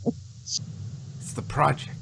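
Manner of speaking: a slow whispered reminder; whispered correction. Real, not acted. Correcting the co-host in a slow whisper. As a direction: whisper the word project, slow. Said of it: "kept calling it the Sontaran Experiment".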